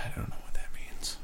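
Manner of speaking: soft unvoiced whisper; whispered aside. Real, not acted. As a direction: whisper it as a side remark. Aside after reading comment.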